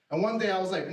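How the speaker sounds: ordinary talk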